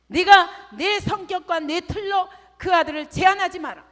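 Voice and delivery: loud, high thundering rebuke; God's rebuke. Retold or imitated. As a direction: shout it down as God scolding. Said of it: "하나님은 저를 책망하셨어요". (크게 외치며 책망하는 하나님)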